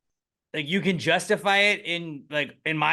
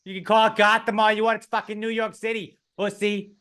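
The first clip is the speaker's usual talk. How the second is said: higher, cocky New York Italian; mock wiseguy boast; 6 semitones higher than usual. Italian mobster brags to Batman, mock cocky tone. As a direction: brag about running the town.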